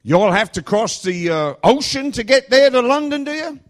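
high, loud Alabama questioning; mock Alabama accent. Driver asking about London, loud high Alabama accent. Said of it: "This accent is a Vaughnabama accent".

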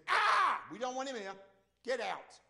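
high cry, then shooing away; yelp, then send him packing; acted alarmed rejection. Townsfolk turning Jesus away, acted alarmed rejection.

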